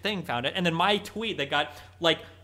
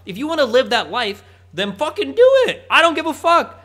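rising to a loud, high shout; furious rant, building to a yell; angry rant; 4 semitones higher, 6 dB louder than usual. Real, not acted. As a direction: let the anger boil over, louder and louder. Ranting at a streamer.

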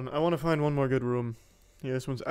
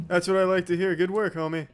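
loud, happy praise; excited cheer; 6 semitones higher, 9 dB louder than usual. Real, not acted. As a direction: cheer him on loudly. Cheering a friend's weight loss.

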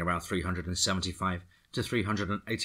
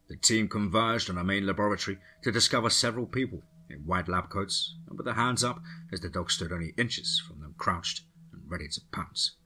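plain reading voice

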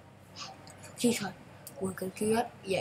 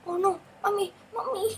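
mock panic; 10 semitones higher, 5 dB louder than usual. Car crash, mock panic.

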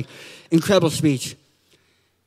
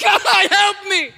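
high, shouted plea; mock desperate cry; 9 semitones higher than usual. Churchgoer drops the mask before God, mock desperate cry. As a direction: break suddenly from pretence into a hammed-up wail.

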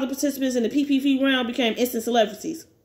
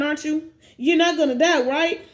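plain reading voice

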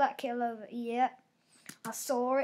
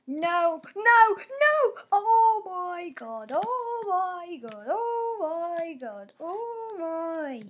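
high, loud anguished wail, dragged slow on oh my God; despairing cry; 6 semitones higher, 8 dB louder, much slower than usual. Real, not acted. Gamer wailing at his screen as he is about to lose. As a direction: cry out as the round slips away. Dying in game, cries out.

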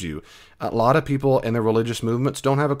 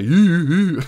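high, loud comic 'you'; exaggerated goofy accuser; silly mock voice; 8 semitones higher, 6 dB louder, slower than usual. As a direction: hoot it out in a silly voice. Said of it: "whether they talk like that".

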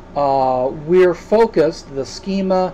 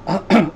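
clears throat before speaking; a quick throat clear; 4 semitones higher than usual. Real, not acted. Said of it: "I'm coughing".

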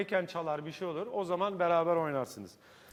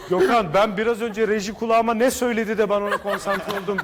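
laughing; 8 dB louder than usual. Real, not acted. Host laughs at sudden drop.

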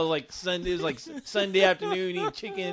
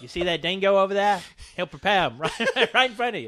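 hammed-up Aussie pitch with giggles; Australian accent. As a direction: sell it in a mock Australian voice. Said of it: "I'm doing an Australian accent".

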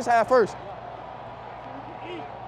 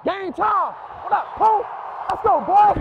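roaring rallying speech; 7 semitones higher, slower than usual. Real, not acted. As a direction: roar it to fire up the team. Firing up teammates before the drive.

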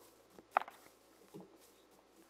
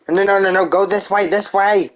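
urgent shout; 10 semitones higher, 6 dB louder than usual. Real, not acted. Fleeing mobs, directing partner.

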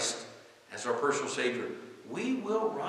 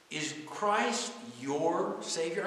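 slow question, "your" drawn out and emphasised; stress on "your"; 3 semitones higher, 3 dB quieter, much slower than usual. Real, not acted. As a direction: lean on the word "your". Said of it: "I underline the word your".

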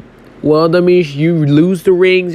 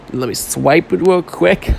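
normal talking voice

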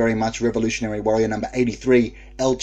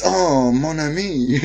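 slow, higher mock French lover voice; Pepe Le Pew impression; 6 semitones higher, 4 dB louder, slower than usual. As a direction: purr it like a cartoon French skunk. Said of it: "Pepe Le Pew reference right there".

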